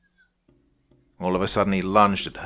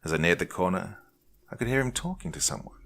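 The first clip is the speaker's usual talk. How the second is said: plain reading voice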